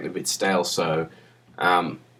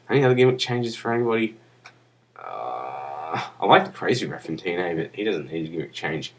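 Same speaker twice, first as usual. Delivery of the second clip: ordinary delivery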